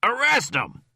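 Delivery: high loud yell; shout. A narrator's reading. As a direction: bellow the command. Rhyme shouts.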